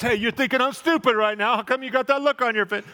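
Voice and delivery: high, loud mock indignant husband with a laugh; hammed-up husband. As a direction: play the husband catching her smirk. Acted husband reading wife's thoughts.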